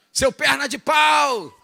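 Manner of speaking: stretched football-stand insult; imitating a stadium yell. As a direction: shout at a clumsy player from the stands. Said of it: "às vezes se levanta e fala".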